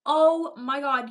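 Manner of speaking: loud, slow oh my god; excited. Real, not acted. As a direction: burst out in agreement. Excited agreement with chat.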